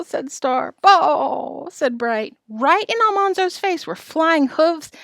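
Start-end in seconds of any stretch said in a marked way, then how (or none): 0.8-1.7 loud, higher, drawn-out bleat; stretched animal cry; bawling calf. A narrator's reading. Calf bellows. 2.5-4.9 high-pitched storytelling rush of feeling; breathless-with-alarm storyteller; excited narration. A narrator's reading. Narrating chaotic runaway.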